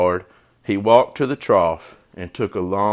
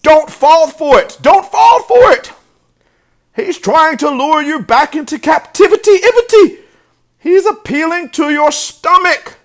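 very high, loud, frantic goose warning; shrieking goose trying to stop a friend; alarmed goose; 11 dB louder than usual. A narrator's reading. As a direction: plead at full volume as a goose. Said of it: "said the goose".

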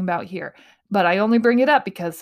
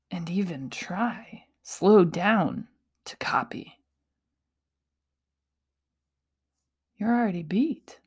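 whisper; 4 dB quieter than usual. Real, not acted. Hushed motivational aside.

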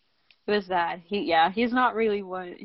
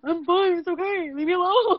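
high, tearful plea to be left alone; pushing away help through tears; imitating sobbing; 9 semitones higher than usual. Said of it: "As I'm sobbing".

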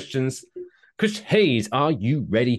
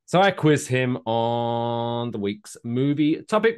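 normal talking voice

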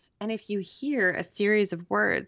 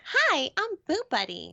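high, sweet toy bear voice; squeaky talking teddy bear; creepy little bear voice; 11 semitones higher than usual. Retold or imitated. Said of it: "the creepiest little bear voice I've ever heard".

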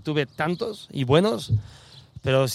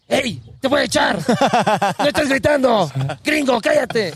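high, loud mock outburst from the referee; hammed-up shouted telling-off; retold scolding shout; 8 semitones higher, 5 dB louder than usual. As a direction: yell a warning as an exasperated referee. Acts referee scolding him loudly.

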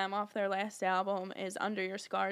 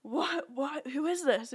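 baffled, higher-pitched mock astonishment; retold disbelief; 4 semitones higher, slower than usual. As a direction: re-enact mild shock, voice lifted higher. Retelling her shocked reaction.

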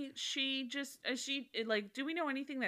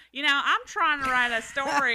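playful Texan put-on; Texan accent; 4 semitones higher, 8 dB louder than usual. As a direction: ham up a Texan twang while laughing. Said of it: "now I'm going Texan".

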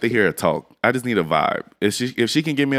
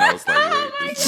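high, startled surprise; surprised. Retold or imitated. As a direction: squeal of surprise. Surprised retold reaction.

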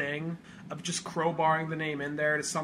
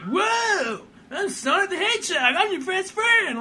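much higher, louder excited Sonic voice; cartoon Sonic; 7 dB louder than usual. Retelling the movie pitch, squeaky excited Sonic. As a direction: play an eager cartoon hedgehog. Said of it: "Sonic comes out of the Sega Saturn".

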